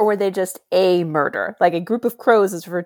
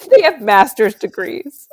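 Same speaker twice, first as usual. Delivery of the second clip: ordinary delivery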